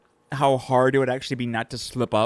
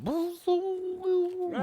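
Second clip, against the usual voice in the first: high falsetto. Pretend.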